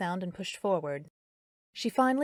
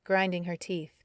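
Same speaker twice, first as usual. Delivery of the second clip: ordinary delivery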